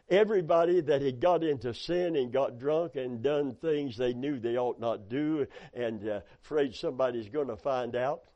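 ordinary talk

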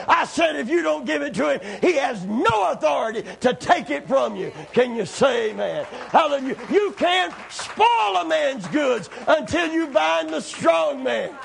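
fervent pulpit shouting; full-force preaching. Acted.

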